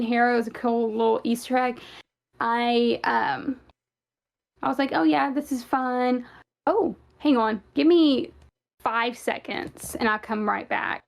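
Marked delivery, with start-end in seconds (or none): none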